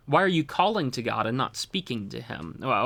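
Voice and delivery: ordinary talk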